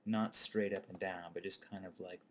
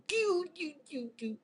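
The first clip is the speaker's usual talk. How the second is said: high squealing doo doo; Roscoe P. Coltrane giggle; 8 dB louder, slower than usual. Retold or imitated. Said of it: "kinda make this noise".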